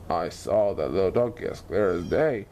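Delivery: low, puzzled lion grumbling to himself; gruff lion mutter. A narrator's reading. The lion, confused by the vanished donkey, muttering. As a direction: grumble it, baffled and annoyed. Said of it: "he muttered".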